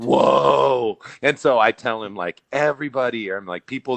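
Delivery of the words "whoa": surprised whoa. Retold or imitated. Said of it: "And I'm just like".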